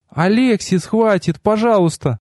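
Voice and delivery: high female voice, pleading; pleading goddess. A narrator's reading. Goddess begs him to stop singing. (умоляющий высокий женский голос)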